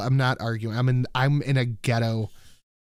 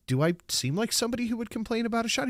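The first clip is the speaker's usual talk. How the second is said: mock indignant; 11 semitones higher, faster than usual. Retelling indignant question to doctor.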